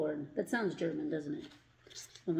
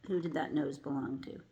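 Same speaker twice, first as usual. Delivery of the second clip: usual speaking voice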